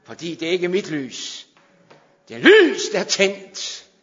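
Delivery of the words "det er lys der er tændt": loud, high burst on lys; preacher's proclaiming shout. Acted. Preacher proclaiming the Lord's light to the church, loud. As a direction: ring it out to the hall.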